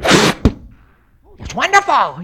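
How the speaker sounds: drawn-out imitation of a thrilled father; delighted dad. Dad praises his son after a bike crash, delighted. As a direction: play Dad lit up like a Christmas tree. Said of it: "my daddy lit up like a Christmas tree".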